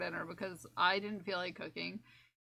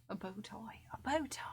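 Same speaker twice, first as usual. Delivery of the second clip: whisper; 10 dB quieter than usual. Pretend. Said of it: "I slipped into a British accent".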